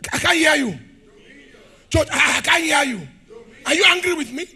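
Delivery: loud call to the church. Real, not acted. Preacher calls out to silent church, loud. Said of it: "calling on the congregation".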